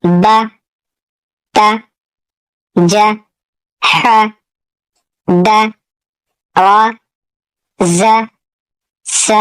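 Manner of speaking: ब त ज read one by one with pauses; teacher's slow drill of short vowels. Shown as a demonstration. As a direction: read each syllable short and clear, pausing between. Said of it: "मैं इसे एक मरतबा पढ़ा देता हूँ".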